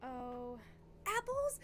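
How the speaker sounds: high, loud astonished question; thrilled disbelief. Real, not acted. Player spotting island fruit, real excited shock. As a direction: squeal it as a surprised question. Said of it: "I got really excited".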